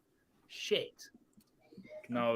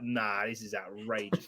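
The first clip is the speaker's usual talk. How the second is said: low, slow, deadpan disbelief; mock outrage; 7 semitones lower, 9 dB quieter, slower than usual. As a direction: drag it out like you can't believe it. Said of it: "and I was like".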